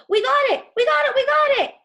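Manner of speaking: high, loud triumphant shout; excited yell. Acted. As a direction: shout it out in victory. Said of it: "yelled Tom".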